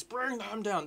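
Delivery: high, drawn-out mock war cry; Lord of the Rings battle cry. Gamer quoting Lord of the Rings, mock battle cry. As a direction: stretch it out like a film battle cry. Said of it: "It's like Lord of the Rings".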